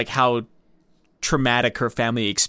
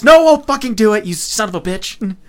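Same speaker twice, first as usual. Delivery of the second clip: much higher, louder hammed-up yell; defiant shout; 8 semitones higher, 10 dB louder than usual. Actress caving in and shouting at the director. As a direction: shout it like you're giving in out of spite.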